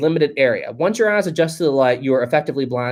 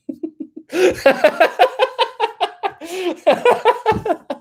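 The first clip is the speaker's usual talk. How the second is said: long high-pitched laughing fit; real, breathless cracking up; helpless laughter. Real, not acted. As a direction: laugh until you cry. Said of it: "I'm crying at that one".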